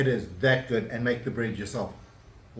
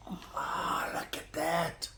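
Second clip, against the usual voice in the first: whispering; 2 semitones higher, 9 dB quieter, much slower than usual. Real, not acted. Awed by fresh bread.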